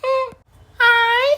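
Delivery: usual speaking voice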